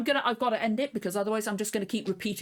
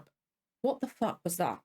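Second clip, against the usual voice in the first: usual speaking voice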